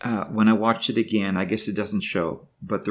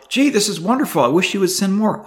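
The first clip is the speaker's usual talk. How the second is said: imagined wife, voiced much higher and louder; mock delighted wife; 10 semitones higher, 5 dB louder than usual. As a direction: play the grateful wife he wishes for, pitched high and bright with pleasure. Imagined grateful reply from wife.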